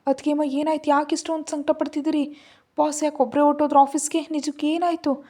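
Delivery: high-pitched, alarmed questions; acted alarm, voice pitched well up; distraught questioning. A narrator's reading. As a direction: ask in dismay what went wrong. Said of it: "ತುಂಬ ಕಂಗಾಲಾಗ್ಬಿಟ್ಟ".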